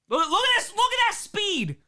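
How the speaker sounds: high-pitched cry of amazement; excited exclamation. Real, not acted. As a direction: marvel aloud, pitch climbing high. Excited reaction to footage.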